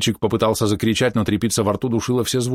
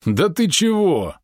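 high, slow, amused wail; groaning through laughter; 10 semitones higher, slower than usual. A narrator's reading. Merchant mocks the worried helmsman, moaning with amusement. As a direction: stretch it out as a mirthful moan. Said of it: "сквозь смех простонал купец".

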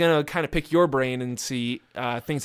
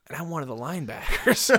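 normal talking voice

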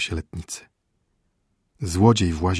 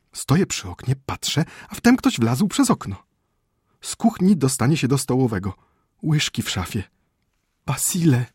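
high, urgent voice of a frightened wife; anxious wife reporting a burglar; alarmed wife; 7 semitones higher, 4 dB louder than usual. Acted. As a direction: plead with him to wake up.

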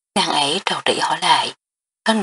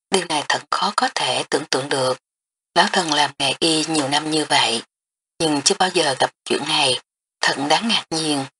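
ordinary delivery, nothing marked

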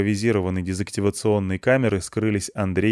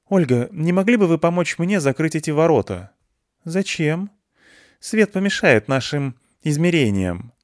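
dialogue voices; 7 semitones higher than usual. Acted. Dialogue curator and Olga.

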